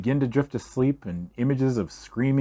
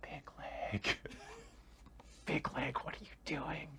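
breathy whisper, much softer; mock whisper; 4 semitones higher, 21 dB quieter than usual. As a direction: hush it right into his ear. Mimics whispering the name.

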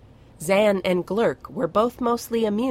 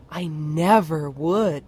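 a touch of a gasp, slow and louder; 5 dB louder, slower than usual. Acted. Little dragon protesting he would never hurt the baby, mild gasp. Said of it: "Firion gasped".

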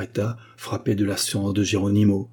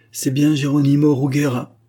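loud, higher confirmation; raised-voice accusation; voice from crowd; 5 semitones higher, 5 dB louder than usual. A narrator's reading. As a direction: cry out the identification. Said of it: "dit un autre".

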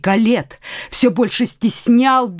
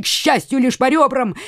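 ordinary delivery, nothing marked

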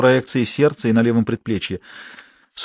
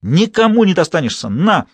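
louder, higher furious cry; frenzied rage; 7 semitones higher, 3 dB louder than usual. A narrator's reading. Mother-in-law stabbing ex daughter-in-law, raging. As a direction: hurl it with each blow.

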